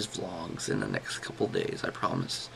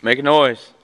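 loud call; 4 semitones higher, 13 dB louder than usual. Real, not acted. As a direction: call out loudly into the dark. Calling out to spirits.

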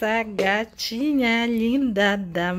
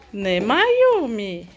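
ordinary delivery

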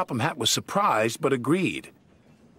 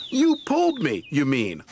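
arguing; 3 semitones higher than usual. Acted. Douglas arguing back at Donald, cross. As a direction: push back in the quarrel. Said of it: "argued Douglas".

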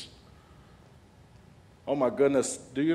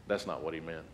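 enemy's hushed voice; 7 semitones lower, 10 dB quieter than usual. Retold or imitated. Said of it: "the enemy immediately comes and goes".

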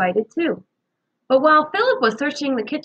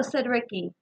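ordinary delivery, nothing marked

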